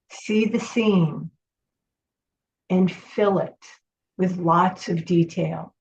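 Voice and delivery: long calm pauses, gentle guidance; guided meditation. Acted. Leads a guided visualization.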